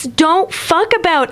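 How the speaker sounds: mock his fake British accent. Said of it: "you're Canadian... not British".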